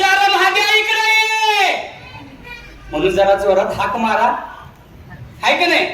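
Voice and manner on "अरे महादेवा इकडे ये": high-pitched drawn-out holler; loud calling out. Shown as a demonstration. Caught by a thief, calling the neighbour for help, high holler. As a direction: holler for a neighbour's help, stretching the last word. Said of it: "जोरात ओरडशील".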